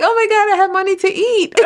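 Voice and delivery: very high, loud squeal of delight; giddy high-pitched relief, dissolving into laughs; laughing, overjoyed. Retold or imitated. Retold joy over small tips.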